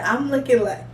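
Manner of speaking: ordinary delivery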